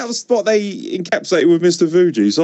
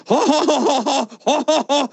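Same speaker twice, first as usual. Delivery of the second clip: high mock laugh of a confused tourist, ha ha ha; imitated tourist laugh; 12 semitones higher than usual. Tourist laughing blankly at the interviewer's question. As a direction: laugh along without understanding a word. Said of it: "And he goes".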